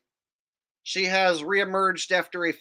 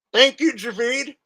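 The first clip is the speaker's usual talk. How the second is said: put-on voice; 6 semitones higher, 4 dB louder than usual. Shown as a demonstration. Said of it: "talk like this".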